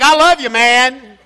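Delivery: slow, laughing macho voice; laughing bro impression. Shown as a demonstration. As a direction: ham up the guy-to-guy hedge. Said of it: "It's like".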